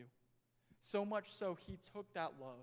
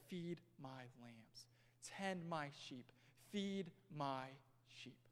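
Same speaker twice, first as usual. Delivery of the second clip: slow, soft, lower and tender; Jesus quietly entrusting Peter; gentle command; 5 semitones lower, 6 dB quieter, much slower than usual. Acted.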